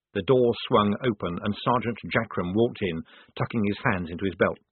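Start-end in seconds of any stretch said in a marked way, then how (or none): none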